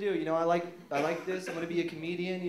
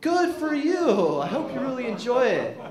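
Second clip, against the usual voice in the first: high, loud mock congratulation; mocking a friend's career choice; sarcastic reply; 8 semitones higher, 7 dB louder than usual.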